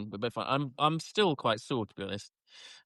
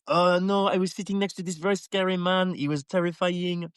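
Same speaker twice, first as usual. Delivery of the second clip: raised pitch, lightly voiced child; mock French child; 6 semitones higher than usual. Child telling of the scary man beside her, mock-frightened higher voice. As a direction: pretend little girl recounting her ordeal. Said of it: "With a French accent the whole time".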